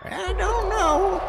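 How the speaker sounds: put-on very high, drawn-out old granny quaver; old grandmother voice. Player mocking her own voice as an old grandmother. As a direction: stretch it out like a creaky old granny. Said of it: "That was like an old grandmother".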